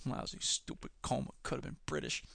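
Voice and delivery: whispered Bender. Retold or imitated. Bender sulking in his coma, whispered. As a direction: mutter it under your breath. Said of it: "like Bender on Futurama".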